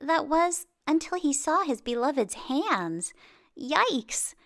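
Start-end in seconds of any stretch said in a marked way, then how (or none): none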